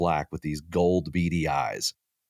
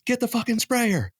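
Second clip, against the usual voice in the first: high, loud panicked shout across the room; frantic yell; 4 dB louder than usual. Retold or imitated. Yelling to his wife to grab the sprayer, frantic. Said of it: "so I'm yelling at my wife".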